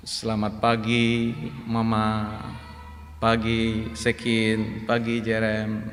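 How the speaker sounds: soft, slow morning greetings to family. Shown as a demonstration. Pastor demonstrating greeting his family softly. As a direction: greet the family gently and slowly. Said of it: "jadi saya begini".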